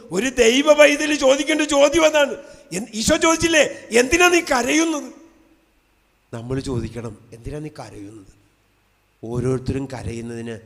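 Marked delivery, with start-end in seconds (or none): 0.1-5.1 preaching shout. Acted. Preacher shouts Jesus's question to congregation. 7.3-8.3 quietly put, gentle drop in volume; soft question. Acted. Same question repeated softly after shout.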